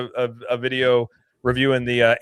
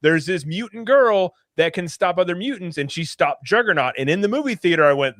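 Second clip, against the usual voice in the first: usual speaking voice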